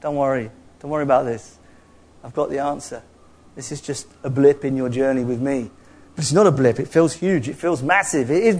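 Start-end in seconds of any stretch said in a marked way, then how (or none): 0.0-5.7 soft, lower, reassuring voice; God reassuring a believer who lost his job, softly; gentle reassurance. Retold or imitated. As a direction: comfort a worried friend gently. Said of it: "he's saying".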